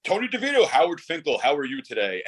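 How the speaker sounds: lightly imitated announcer, high on the name; a loose take on the ring announcer's phone greeting; a hint of a ring announcer calling on the phone; Howard Finkel voice. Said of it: "I would pop when that man would call my house".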